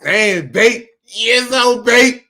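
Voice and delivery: loud, excited swooning at the video; playful loud swoon of delight; mock turned-on reaction.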